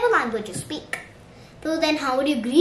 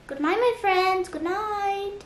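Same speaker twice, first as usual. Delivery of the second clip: high, lilting, softening farewell; sing-song good night; 7 semitones higher than usual. Shown as a demonstration. Wishing friends good night, sing-song.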